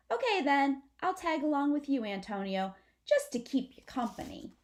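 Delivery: cheerful brother tagging along; lightly voiced possum boy; possum Amlet. Amlet speaks to Antonio.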